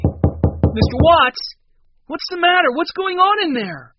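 high, loud alarmed demand; furious shouting. A narrator's reading. Boy pounding at the cabin door, furious shouting. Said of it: "Henry knocked furiously".

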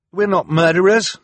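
high, loud, urgent protest; frantic. A narrator's reading. Klaus translating for Sunny to the crowd, frantic. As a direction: protest it frantically, voice pitched right up. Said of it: "Klaus translated frantically".

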